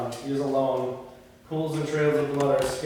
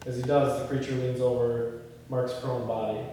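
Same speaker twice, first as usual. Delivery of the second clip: ordinary talk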